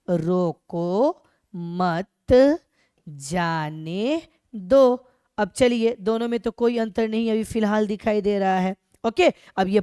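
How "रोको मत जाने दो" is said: drawn-out words, evenly spaced; slow dictation. Shown as a demonstration. Teacher writing the same sentence for students, slow dictation. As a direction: dictate it very slowly, word by word. हम यही वाक्य लिख देते हैं.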